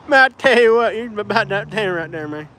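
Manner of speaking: imitated Appalachian hillbilly twang; Alabama neighbor's accent. Imitating his old Alabama neighbor, hillbilly accent. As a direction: mimic a backwoods Alabama accent. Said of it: "and he spoke like that".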